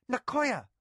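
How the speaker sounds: a sharp high snap of the name; high sharp rebuke; snapped. A narrator's reading. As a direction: cut her off sharply. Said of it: "Mara snapped".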